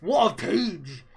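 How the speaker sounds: loud, slow exclamation; stunned outburst. Real, not acted. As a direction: drag it out in disbelief. Reader reacting to a shocking page.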